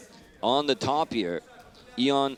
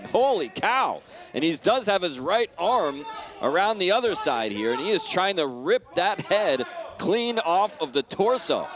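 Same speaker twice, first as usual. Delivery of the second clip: excited; 6 semitones higher than usual. Real, not acted. Choke in fight commentary.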